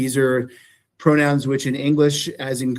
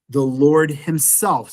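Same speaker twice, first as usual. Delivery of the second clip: ordinary delivery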